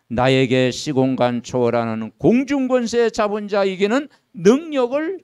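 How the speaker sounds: loud, pleading prayer voice; fervent prayer. Acted. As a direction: pray it out with force. (힘 있는 기도)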